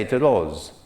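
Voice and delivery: Italian accent, rose as "lose" with a rolled L; light Italian accent, rose said as "lose". Shown as a demonstration. Teacher imitating an Italian saying rose. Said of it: "if you're Italian".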